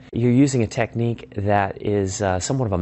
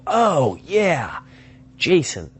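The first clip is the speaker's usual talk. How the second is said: high, swooping oh of remembering; sudden recognition; 7 semitones higher, much slower than usual. Acted. Classmate finally remembers old friend, delighted recognition. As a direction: let it dawn on you in a high falling swoop.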